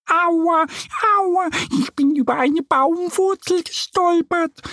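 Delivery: squeaky little duck whimpering about her fall; sniffling little duck. A narrator's reading. Duckling Annie tripped over a root, sniffling high. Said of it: "schnieft sie".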